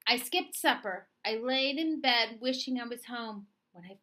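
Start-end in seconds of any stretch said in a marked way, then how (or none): none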